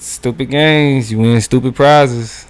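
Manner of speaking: slow, loud, spelling out the proverb; emphatic slow saying. Real, not acted. Cohost finishing the saying about stupid games, slow and loud. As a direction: lean on every word like a well-worn saying. Completing the well-known saying.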